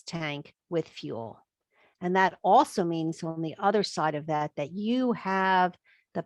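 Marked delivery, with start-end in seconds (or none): none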